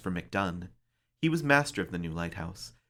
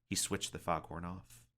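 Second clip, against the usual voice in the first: ordinary delivery, nothing marked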